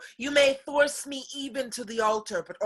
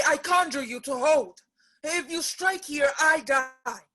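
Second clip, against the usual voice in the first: ordinary delivery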